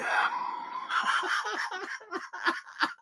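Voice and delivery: high airy shriek of laughter; cracking up at own joke. Real, not acted. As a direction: howl with laughter.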